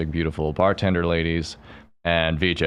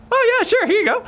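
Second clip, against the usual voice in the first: very high falsetto, louder, sing-out agreement; bartender lady; 5 dB louder than usual. Retold or imitated. Bartender happily handing over her number, mock falsetto. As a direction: put on a squeaky mock female voice. Voices second bartender saying yes.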